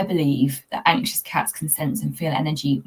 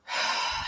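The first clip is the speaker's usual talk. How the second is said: slow unvoiced out-breath; a deep breath out to calm down; deep calming sigh; 7 dB quieter than usual. Shown as a demonstration. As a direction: let a long breath go. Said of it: "take a deep breath".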